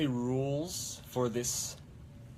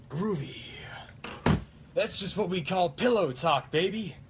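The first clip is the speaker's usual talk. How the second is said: higher, louder swaggering hero; Ash; 7 semitones higher, 4 dB louder than usual. Acted. Cosplayer quoting Ash's pillow talk line, raised-voice impression. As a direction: toss out the catchphrase as a cocky hero. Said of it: "best Ash impression right now".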